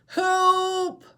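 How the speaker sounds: high, loud call for help; Baby Seal calling out. Shown as a demonstration. Teacher voicing Baby Seal calling for help. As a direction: shout into the storm. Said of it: "show you how to use voices".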